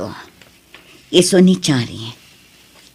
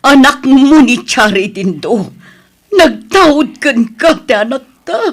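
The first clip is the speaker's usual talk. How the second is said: high, loud and slow tearful plea; desperate pleading; 10 semitones higher, 5 dB louder, slower than usual. Acted. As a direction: beg him to own his child. Mother begs father to accept daughter. (agpakpakaasi a sangsangit)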